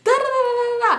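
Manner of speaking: high screaming through tears; crying scream. Retold or imitated. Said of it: "I'm screaming".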